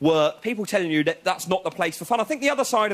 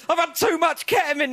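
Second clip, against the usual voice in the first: panicked raver; 11 semitones higher than usual. Retold or imitated. Drugged bloke in the next tent, panicked shout. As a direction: yell like you've overdone it. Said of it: "this bloke was saying".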